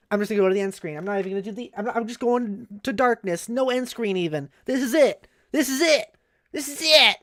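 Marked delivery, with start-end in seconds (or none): 4.6-7.2 each repeat higher and louder; a mock-dramatic ending to the stream; dramatic build. Shown as a demonstration. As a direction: build it up like a grand finale.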